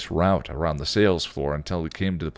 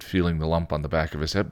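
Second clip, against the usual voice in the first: ordinary delivery, nothing marked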